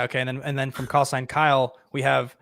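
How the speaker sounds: normal talking voice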